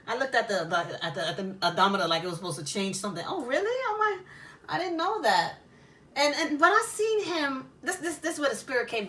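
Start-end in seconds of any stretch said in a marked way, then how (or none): 3.3-5.5 mock surprised, pitched high; high, mock-innocent surprise; feigned innocence. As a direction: play dumb, all wide-eyed innocence. Playing innocent to cop.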